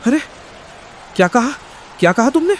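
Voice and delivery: husband snapping back at his wife's grumbling; indignant disbelief. Acted. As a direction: bristle at the insult. Husband angered by wife's complaint.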